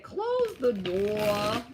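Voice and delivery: slow New York accent imitation; Tyler. Quoting where the line came from, in a slow imitated New York accent. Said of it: "I got that from Tyler. He said".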